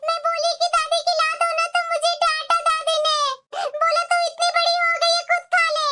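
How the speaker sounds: put-on high-pitched child voice, tattling; complaining little child. Child tells mother that grandma scolded her, complaining. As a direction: play a little kid telling on grandma.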